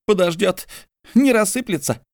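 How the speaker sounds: high grumbling brush-off; Mordecai grumbling. A narrator's reading.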